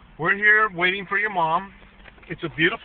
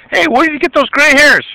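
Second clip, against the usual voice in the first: playful teasing; 12 semitones higher, 6 dB louder than usual. Real, not acted. Parent teasing toddler about gray hairs, high and loud.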